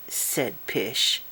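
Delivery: plain reading voice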